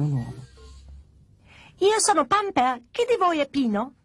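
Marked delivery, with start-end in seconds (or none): 1.8-3.9 put-on high-pitched, bratty baby doll; screechy baby voice. New doll demands to know who Pino is, screechy baby. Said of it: "strideva con la sua terribile voce da bebè".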